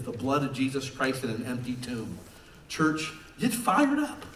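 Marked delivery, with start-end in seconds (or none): 2.7-4.2 fired-up call. Real, not acted. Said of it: "calling church to fire up".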